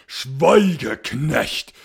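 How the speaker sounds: loud high furious order of a ghost lord; commanding scream. A narrator's reading. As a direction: silence the servant with a domineering shout. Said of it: "schrie der schwarze Graf gebieterisch".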